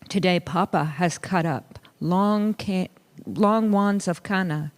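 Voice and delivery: ordinary delivery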